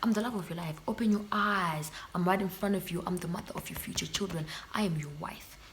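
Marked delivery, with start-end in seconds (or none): 3.1-5.6 a hushed, tender whisper; whispered to a man who overlooks her. Real, not acted. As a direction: breathe it as a soft declaration. Whispered mock devotion.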